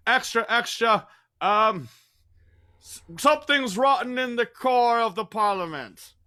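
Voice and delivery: high, loud Cockney newsboy; newsie headline cry. Shown as a demonstration. Example headline for the players, high loud newsie cry. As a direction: cry out the pun like a paper seller. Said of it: "I'll be a little newsy in a hat".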